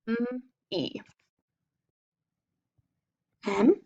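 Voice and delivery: usual speaking voice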